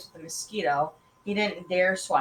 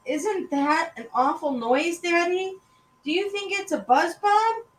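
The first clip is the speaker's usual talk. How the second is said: put-on high-pitched kid asking anxiously; worried child; 10 semitones higher, 3 dB louder than usual. Son asking Daddy about an awful noise, high and worried. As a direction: lift each question high, like a small boy.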